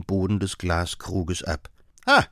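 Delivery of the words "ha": short, delighted shout; triumphant cry. A narrator's reading. Holmes watching the reagent work, exulting. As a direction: bark out a triumphant ha. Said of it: "rief er".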